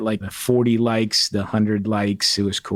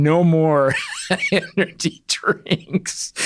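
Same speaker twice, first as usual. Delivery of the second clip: laughing through it; 6 semitones higher, much slower than usual. Retold or imitated. Reading sign, cracking up.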